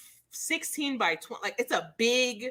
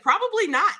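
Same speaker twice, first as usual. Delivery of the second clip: squeaky breathless reply; 9 semitones higher, 7 dB louder than usual. Retold or imitated. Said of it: "And I was like".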